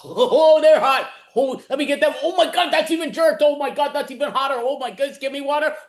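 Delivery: high, loud, panicked yelping; frantic panic. Acted. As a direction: mouth burning from hot wings. Improv burning from hot wings.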